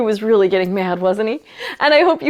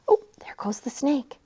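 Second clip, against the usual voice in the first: hushed whisper; 11 dB quieter, faster than usual. Acted. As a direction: whisper it like a secret. Reading a picture book aloud.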